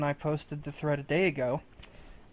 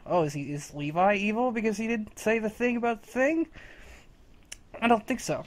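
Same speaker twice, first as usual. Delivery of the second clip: lightly higher mocking questioner; mock questioning voice; 7 semitones higher than usual. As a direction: parody a worried fan, a touch higher. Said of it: "how last chapter we talked".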